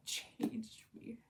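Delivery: a reverent, voiceless aside; awed whisper. Real, not acted. To viewers about the Beartown trilogy, awed whisper. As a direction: breathe it out in a hushed whisper.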